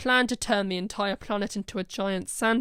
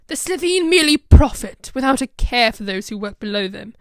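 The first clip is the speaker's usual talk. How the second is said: angry outburst; 10 dB louder than usual. Acted. Villain rants at the Doctor.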